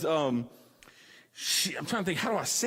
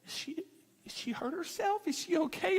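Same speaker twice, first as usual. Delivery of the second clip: soft, higher worried whisper; worried onlookers; 9 semitones higher, 6 dB quieter than usual. Retold or imitated. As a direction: ask it hushed, pitched up.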